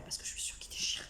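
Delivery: unvoiced-sounding, very soft whisper. Real, not acted. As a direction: drop to a confiding whisper to end the list. List of reasons sinks to whisper.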